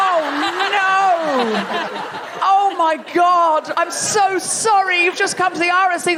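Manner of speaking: high, drawn-out dismay; mock-horrified apology. Comic to tourist expecting Shakespeare, hammed-up horror. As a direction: apologise as if it's a catastrophe.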